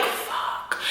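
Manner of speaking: breathless whispered "Fuck"; whispered curse. Retold or imitated. Reacting to Angela's death, a whispered curse. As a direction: drop it under your breath. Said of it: "I'm like".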